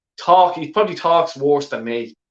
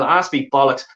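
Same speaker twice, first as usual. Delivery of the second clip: ordinary talk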